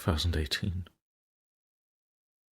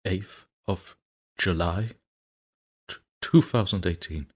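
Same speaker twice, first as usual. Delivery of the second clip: ordinary talk, nothing marked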